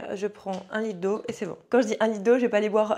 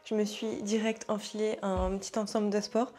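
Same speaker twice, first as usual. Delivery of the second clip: usual speaking voice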